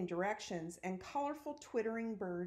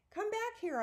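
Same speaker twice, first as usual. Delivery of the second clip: high-pitched calling out, moderately raised; narrated yell, pitched way up; 14 semitones higher than usual. A narrator's reading. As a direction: call the wand back with a high, light yell. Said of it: "I yelled".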